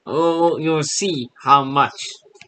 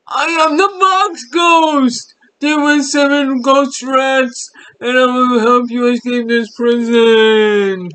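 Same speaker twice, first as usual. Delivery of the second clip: Box Ghost impression; 8 semitones higher, 5 dB louder than usual. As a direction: shout it like a hammy cartoon ghost. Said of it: "That is my Box Ghost impression".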